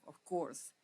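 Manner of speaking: ordinary delivery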